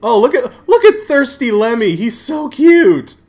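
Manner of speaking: high, loud squeal over something cute; thrilled, adoring exclamation; delighted gushing. Real, not acted. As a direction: gush with rising delight. Gushes over cute character.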